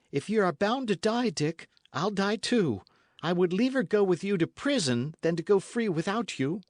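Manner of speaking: lightly voiced loyal lad; Matcham, devoted. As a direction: swear you'll die with him.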